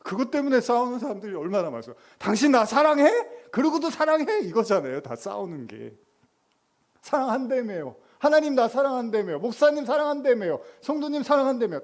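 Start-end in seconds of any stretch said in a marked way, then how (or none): 2.2-4.4 very high, loud nagging question; demanding spouse. Acted. 7.0-11.8 high, loud petulant whine; whiny complaint. Acted.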